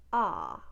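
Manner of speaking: "ah" with corners widened, jaw dropped; wide relaxed ah; ah vowel. Shown as a demonstration. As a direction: open it wide to "ah". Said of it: "to ah".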